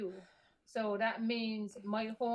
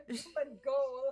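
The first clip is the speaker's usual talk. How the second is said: laughing correction; 3 semitones higher than usual. Real, not acted.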